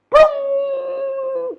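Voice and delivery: high, loud explosive boom. Acted. Batter hitting the sizzling oil, booming. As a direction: burst out the sound of a splash.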